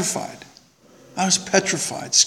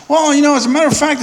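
proud; 10 semitones higher, 4 dB louder, faster than usual. Retold or imitated. Retelling his proud reply.

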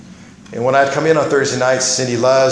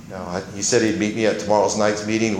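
ordinary delivery

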